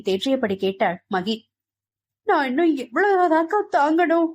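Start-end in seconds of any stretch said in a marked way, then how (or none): 2.3-4.3 higher, wailing cry of despair; narrated loud sobbing question. A narrator's reading. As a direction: sob the question out, breaking. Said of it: "சத்தமாக கேவியபடி".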